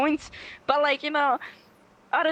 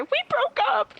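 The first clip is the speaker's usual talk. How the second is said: much higher mock dramatic voice; mock dramatic girl; 3 dB louder than usual. Mocking a dramatic girl, high squeal. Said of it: "and then literally".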